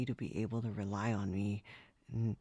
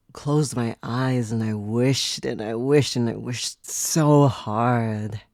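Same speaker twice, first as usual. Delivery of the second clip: building louder through the repeated wishes; intense wishing; 10 dB louder, slower than usual. Acted. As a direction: build up the wish until it bursts out. Recalling an intense wish.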